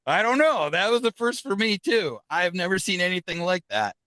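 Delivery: retold amazement, voice pitched high; high-pitched, baffled admission at normal volume; amazed reply. Retold amazed reply after flash.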